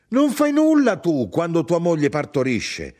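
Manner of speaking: high, loud reproach; exasperated scolding; indignant challenge. Acted. Mother challenges her son.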